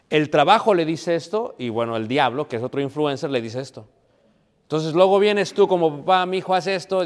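impassioned pulpit build; fiery preaching. Real, not acted. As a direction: drive it home to the congregation. Preaching at full force.

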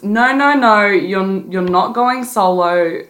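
ordinary delivery

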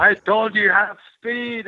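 slow, high, scolding voice of the champion; annoyed wrestling champ. Retold or imitated. Champion scolds the young wrestler for going full speed, slow and high. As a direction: replay the pro's irritated telling-off. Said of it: "You were shooting".

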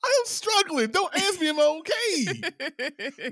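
very high, loud, drawn-out whine; hammed-up pleading; mock melodramatic wail. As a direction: cry it out like a hammed-up sufferer.

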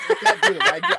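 high, laughing surrender; helpless giggling. Real, not acted. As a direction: give up through laughter. Breaks up failing the accent.

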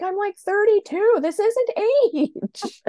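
high, loud, outraged squeak; indignant disbelief. Retold or imitated.

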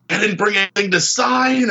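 high, frantic inner voice; flustered panic. Retold or imitated. Thinking in front of his hero, high flustered panic. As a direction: panic like a starstruck fan. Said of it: "some kind of conniption".

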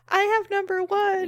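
high, proudly announced; proud announcement. Retold or imitated. Telling the stunned league, high and proud. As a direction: show off the winning number. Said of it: "And I'm like".

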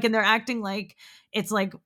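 ordinary delivery

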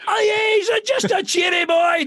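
high, shouted mock local voice; mock Glaswegian. Mocking locals about a cheery boy, shouted high. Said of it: "You sound a bit mental in Scotland if you say".

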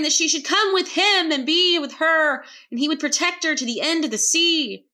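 loud, high, dramatic narration; bold declaration. A narrator's reading. Narrator relaying a pirate's vow to a barmaid, boldly declaring. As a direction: proclaim it like a swashbuckling vow.

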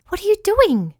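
high-pitched, alarmed question; alarmed cry. A narrator's reading. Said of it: "cried Winky".

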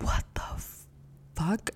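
a hushed, stunned what the fuck; whispered shock. Retold or imitated. Reacting to the cop's news, whispered. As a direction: breathe it out in disbelief.

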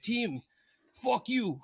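Russian guy's curse. Retold or imitated. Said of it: "He's like".

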